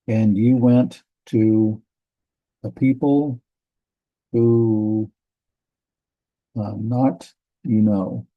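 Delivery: slow word-by-word reading, pausing between phrases; halting, deliberate gloss of a verse; literal translation read-out. Acted. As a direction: translate aloud slowly, piece by piece.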